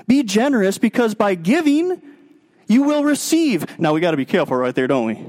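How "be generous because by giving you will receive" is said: building exhortation. Acted. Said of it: "And so Paul says".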